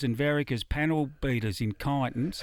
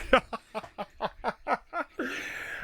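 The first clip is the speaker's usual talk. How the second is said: laughter; 9 semitones higher, 4 dB quieter than usual. Real, not acted. Laughing at silverfish joke.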